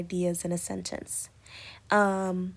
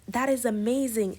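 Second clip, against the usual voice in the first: a bright burst of amazement, a bit louder; excited exclamation; 4 semitones higher, 5 dB louder than usual. Shown as a demonstration. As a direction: read it as a thrilled exclamation. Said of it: "exclamation mark shows strong emotion".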